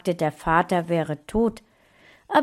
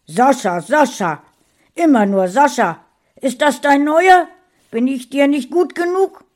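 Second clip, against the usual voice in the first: loud, high, mocking angry outburst; jealous husband railing at his wife; jealous rant; 8 semitones higher, 9 dB louder than usual. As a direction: spit out the jealousy.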